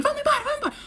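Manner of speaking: high-pitched hurry-up call; mock hustling someone away; imitated urgent ushering. Man dragging the woman off the train, urgent mock call. Man urges partner to leave, retold.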